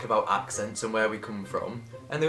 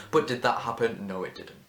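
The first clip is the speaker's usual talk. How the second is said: ordinary delivery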